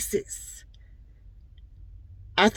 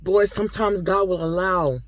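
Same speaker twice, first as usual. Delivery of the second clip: ordinary delivery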